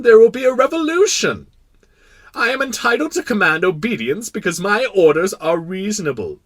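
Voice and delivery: loud, high royal lecture; pompous philosopher king. Acted. King expounding authority to the prince, pompous. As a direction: proclaim your doctrine grandly.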